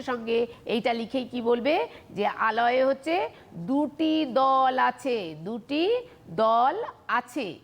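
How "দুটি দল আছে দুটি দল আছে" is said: measured, word-by-word dictation pace; slow dictation. Shown as a demonstration. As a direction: give them time to write. Dictates the answer to pupils.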